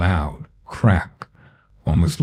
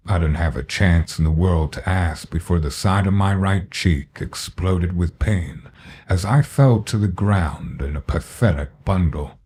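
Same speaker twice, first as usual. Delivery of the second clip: ordinary delivery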